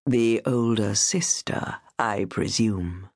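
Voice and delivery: low, slow menacing gentleman; sinister monster voice. A narrator's reading. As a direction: drawl it low like a predator.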